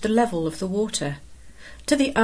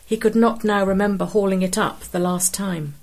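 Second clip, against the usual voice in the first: plain reading voice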